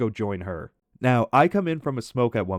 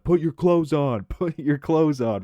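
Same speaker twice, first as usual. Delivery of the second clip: imitating bouncers yelling at a shirtless drunk, higher and louder; mock bouncer shout with a laugh breaking in; 4 semitones higher, 4 dB louder than usual. Bouncers at the club yelling at a shirtless dancer, mock shout. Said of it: "bouncers were yelling at him".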